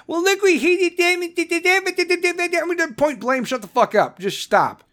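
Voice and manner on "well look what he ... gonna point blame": higher, whiny mock protest; mock a blame-shifter in a higher whine; mock whiner. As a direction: sneer it like a blame-shifting critic. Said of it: "stop fucking pointing".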